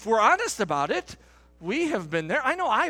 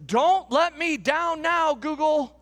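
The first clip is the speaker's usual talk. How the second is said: put-on high, slow child's plea; little boy voice; 7 semitones higher, 4 dB louder than usual. Father voicing his young son pleading with Google, high and slow. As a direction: plead like a seven-year-old boy. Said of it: "in a little seven-year-old voice".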